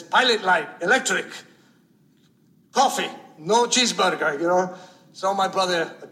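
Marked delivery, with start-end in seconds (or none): 0.0-4.4 clipped verdicts from a stubborn Greek cook; Greek diner owner. Retold or imitated. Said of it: "He says".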